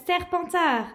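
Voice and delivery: sharp proclamation; hat shouting. Acted. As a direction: cry it out the moment it touches his head. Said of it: "le chapeau s'écria".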